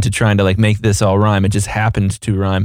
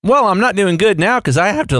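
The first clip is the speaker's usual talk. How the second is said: voice cracking; 9 semitones higher than usual. Real, not acted. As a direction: voice cracks on a word. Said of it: "my voice just cracked".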